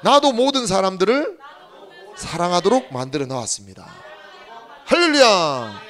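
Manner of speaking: loud, fervent preaching call; leading the congregation in a shouted confession; call and response. Real, not acted. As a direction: proclaim each phrase for the church to echo. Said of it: "따라해봅시다".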